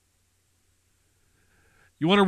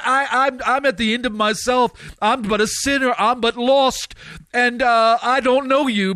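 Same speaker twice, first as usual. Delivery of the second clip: high, urgent sinner's prayer; raised-pitch altar call, driving phrase by phrase; fervent preaching; 6 semitones higher, 4 dB louder than usual. Acted. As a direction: preach it full out, pleading for salvation.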